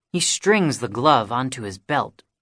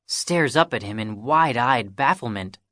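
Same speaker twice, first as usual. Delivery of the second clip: ordinary delivery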